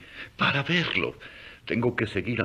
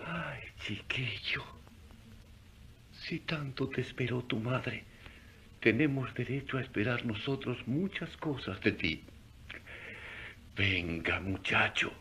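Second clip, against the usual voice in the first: hushed whisper; 8 dB quieter than usual. Acted. Old wanderer whispering to the sleeping newborn, hushed. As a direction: barely breathe the words so the child stays asleep.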